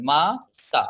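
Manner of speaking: মাতা in two separate beats, মা then তা; split into syllables. Shown as a demonstration. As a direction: break মাতা after মা, each syllable firm. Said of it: "মানে ক্ষুদ্র উচ্চারণ".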